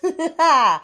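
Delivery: loud high laugh; burst of laughter. Real, not acted. Laughs at herself about going to bed.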